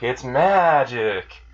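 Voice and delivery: slow, drawn-out showman's "It's magic!"; grand magic reveal. Retold or imitated. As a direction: announce it like a stage magician, stretching every word. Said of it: "puts up his hands like".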